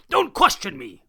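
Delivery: loud, high angry snap; barked order. Acted. Circus boss barking orders at the captain. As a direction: bark it at the captain. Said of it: "barked Mister Payne".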